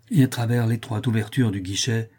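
usual speaking voice

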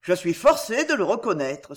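plain reading voice

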